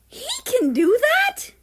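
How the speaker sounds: high, loud rising disbelief; stunned, can't believe it; shocked disbelief. Acted. Russia asks his father in shock, high and loud. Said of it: "Russia's jaw dropped".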